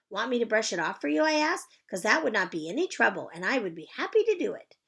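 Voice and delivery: put-on high, bright child's greeting; chirpy little girl. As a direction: sing it out like a cheerful kindergartner. Junie B. character voice.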